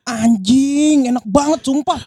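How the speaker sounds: high, gushing delight over coffee; excited tasting. Acted. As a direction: rave about the taste. Staged sponsor skit, tasting coffee.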